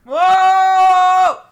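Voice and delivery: loud high surprised gasp of a word; startled whoa. Real, not acted. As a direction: jump back in shock. Startled at talking skull.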